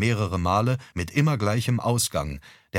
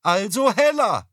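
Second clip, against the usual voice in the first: much higher, loud call from the kitchen; calling back; 12 semitones higher, 5 dB louder than usual. Acted. Mother calls back from the kitchen, high and loud. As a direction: call it back from the other room. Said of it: "Mutter rief zurück".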